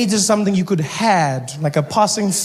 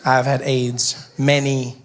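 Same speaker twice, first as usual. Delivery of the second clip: deadpan; 6 semitones lower, slower than usual. Retold or imitated. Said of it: "I'm like".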